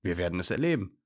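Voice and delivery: usual speaking voice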